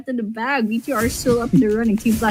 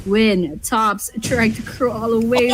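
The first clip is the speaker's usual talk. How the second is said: ordinary talk, nothing marked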